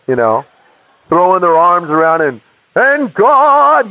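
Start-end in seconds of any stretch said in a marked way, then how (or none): 2.8-3.9 put-on high, swooping old-time preacher; fluctuating preacher voice. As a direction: imitate a fervent preacher's swooping pitch. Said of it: "you know, with the fluctuations".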